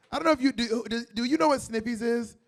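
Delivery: normal talking voice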